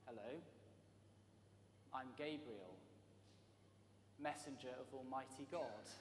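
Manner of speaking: soft, hushed, humble angel voice; a modest messenger speaking softly; gentle angel. Retold or imitated. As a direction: introduce yourself quietly, with no fuss. Angel introduces himself softly.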